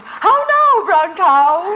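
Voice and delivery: sing-song high-pitched elocution exercise on How now brown cow; over-round every vowel in a high pitch, half laughing; elocution drill. Shown as a demonstration. A nervous fan practising her diction before meeting the star. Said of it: "I'll practice my diction".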